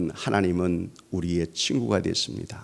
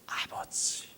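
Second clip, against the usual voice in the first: 1 whispered prayer; 12 dB quieter, much slower than usual. Acted. Intimate prayerful address.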